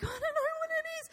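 very high-pitched mock-panic squeal; flustered and frantic, pitch shooting right up; panicked. Wife retelling her fumbling for the phone pin, mock panic.

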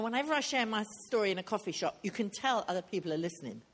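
normal talking voice

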